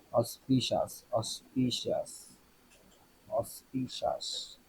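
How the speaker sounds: practising the word to herself under her breath; trying it softly. Real, not acted. Teacher checking pronunciation quietly to herself, murmured tries. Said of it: "কিন্তু এখানে কি auspicious হয়?".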